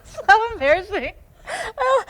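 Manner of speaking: high, loud laughing words. Real, not acted. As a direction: squeal it through laughter. Laughing after laugh burst.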